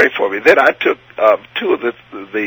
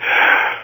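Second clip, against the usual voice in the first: long, unvoiced breath out; deep sigh; 3 dB quieter than usual. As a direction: exhale slowly to calm down. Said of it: "Take a deep breath".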